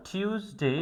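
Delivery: টিউসডে opening "tyus", with a Y glide; correct Tuesday. Shown as a demonstration. Teacher modelling the day, Y glide after T. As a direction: say টিউসডে as "tyoos-day", carefully. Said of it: "বলতে হবে টিউসডে".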